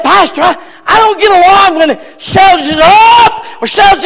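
rising, shouting preacher cadence; fiery preaching. Acted. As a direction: preach it up to a holler. Preaching builds to full force.

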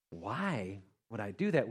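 low, soft, hesitant objection; subdued, doubtful pushback; reluctant questioning. Acted. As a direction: balk quietly at the idea. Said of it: "rather than say".

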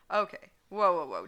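a loud startled whoa; yelling. Acted. Draco yells at Edward in the bar, loud. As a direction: push him off with a loud yell. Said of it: "Draco yelled".

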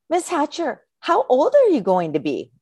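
put-on high child voice, eager; little girl voice. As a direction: ask like a curious six-year-old. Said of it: "another little girl raises her hand and says".

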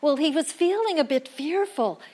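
usual speaking voice

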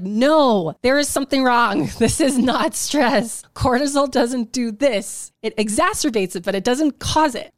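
firmly refusing the diagnosis; heated insistence. Retold or imitated. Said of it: "whew, heated".

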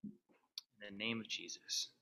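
soft, low, reverent prayer. Real, not acted. Leads an opening prayer.

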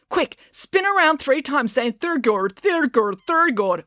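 high-pitched urgent instruction into chant; urgent order. A narrator's reading. Matilda orders Finn to undo bad luck, urgent. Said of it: "ordered Matilda".

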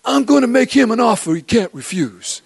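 a light mafia don impression; Godfather quote. Preacher quoting The Godfather to his congregation, in a lightly voiced mobster tone. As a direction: slips into a hint of Brando's godfather. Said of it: "if I were to say to you".

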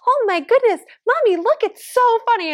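put-on high-pitched kid voice, giddy and pointing; excited toddler voice. Mom retells son's new catchphrase in a high toddler voice. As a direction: play a delighted little boy showing mom something. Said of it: "he literally says".

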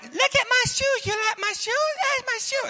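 put-on very high little-girl voice; a little girl showing strangers her shoes. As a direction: chirp it up like a proud five-year-old. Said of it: "she's like".

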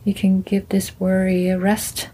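gentle meditation guide; guided meditation. Acted. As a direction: ease them into calm, one body part at a time.

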